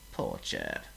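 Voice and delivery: gentle pity; sorry for him. A narrator's reading. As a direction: sigh it out in sympathy. Pity after the fall.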